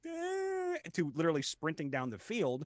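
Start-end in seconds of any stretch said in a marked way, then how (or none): 0.1-0.8 put-on high dumb "eh" like a drooling toddler; clueless baby sound; drooling child noise. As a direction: blank babyish grunt, pitched way up. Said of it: "like a small child going".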